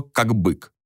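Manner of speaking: usual speaking voice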